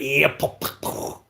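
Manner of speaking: mocking car noise with breathy pops; imitated exhaust pops. Teasing the petrol heads who love all that, imitated exhaust pops. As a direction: mimic a backfiring exhaust. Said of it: "You love all that".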